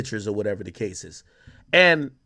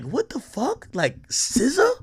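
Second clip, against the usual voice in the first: stunned disbelief; 9 semitones higher, 3 dB quieter, slower than usual. Retold or imitated. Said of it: "I'm like".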